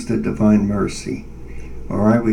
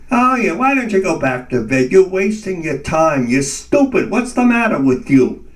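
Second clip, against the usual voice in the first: higher, scornful taunts; taunting inner voice; 5 semitones higher, 3 dB louder than usual. Retold or imitated. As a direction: needle him with contempt. Mocking inner voice taunting him.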